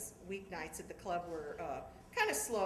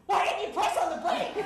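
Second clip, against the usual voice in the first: loud, high, laughing mock yell; scolding through laughter; father yelling; 10 semitones higher, 11 dB louder than usual. Father yells at daughter about the brake, loud mock scold. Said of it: "he yells at me".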